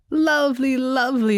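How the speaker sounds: high-pitched, fawning approval; effusive courtier. A narrator's reading. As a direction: coo over the happy couple like a courtier.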